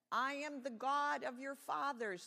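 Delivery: high, loud divine declaration; thundering voice. A narrator's reading. God names himself to Moses, loud grand declaration. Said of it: "the voice thundered".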